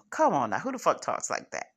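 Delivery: ordinary talk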